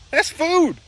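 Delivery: put-on whiny kid. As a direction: whine it like a little kid. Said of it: "We'd be like".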